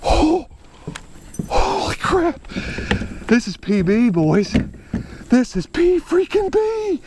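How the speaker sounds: high, breathless jittery joy; overwhelmed and trembling with delight; real giddy excitement; shaking with excitement. Real, not acted. Landing personal best fish.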